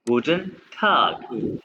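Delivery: गुटन टाग drawn out very slowly, each syllable clear; slow careful गुटन टाग; slow German demo. Shown as a demonstration. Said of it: "आप हर word को pronounce करें".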